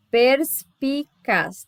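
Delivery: "perspicaz" slowly split as pers-pi-caz; syllable by syllable. Shown as a demonstration. Teacher demonstrating a three-consonant group, syllable by syllable. Shows how the word divides.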